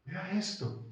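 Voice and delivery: normal talking voice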